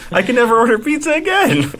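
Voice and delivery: high-pitched, incredulous imitated customer; mock outraged complaint. Banned customer telling his story on podcast, mock outraged. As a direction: pitch it way up like an aggrieved caller.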